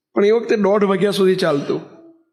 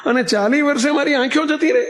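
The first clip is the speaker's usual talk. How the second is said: high, pained cry of despair; father's high, anguished lament to the guru; anguished plea; 8 semitones higher than usual. Retold or imitated. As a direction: plead in anguish as a man who just lost his sight. Blinded father pleads to guru.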